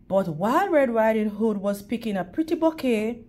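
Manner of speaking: ordinary delivery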